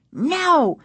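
high, firm refusal; insistent. A narrator's reading. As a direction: refuse to let it happen. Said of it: "Casey insisted".

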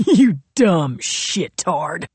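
loud, drawn-out gloating hiss; hissed jeer. A narrator's reading. Royal to Nola after her shot missed, hissed jeer. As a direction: jeer it hard, pitch leaping on the last words. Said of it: "he hissed".

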